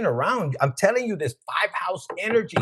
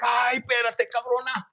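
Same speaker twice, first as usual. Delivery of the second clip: mock shout; 6 semitones higher than usual. Partner's protest, shouted.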